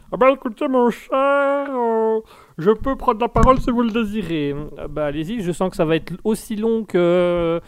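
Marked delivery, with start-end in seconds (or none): 0.1-4.5 new character. Acted. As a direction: stretch the words as a ceremonious guest asking for the floor. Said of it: "vous êtes un nouveau personnage".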